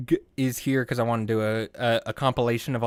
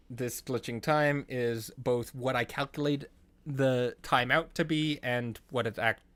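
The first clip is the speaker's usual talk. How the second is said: ordinary talk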